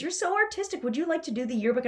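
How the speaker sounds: mock sweet voice of a flatterer; flattering asker. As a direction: imitate a gushing recruiter. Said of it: "Someone goes".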